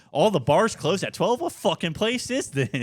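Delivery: loud, high-pitched mock indignation; imitated outrage. Tourist finding bars shut early, loud high mock outrage. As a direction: rant in disbelief, imitating a furious tourist. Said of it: "He was so mad".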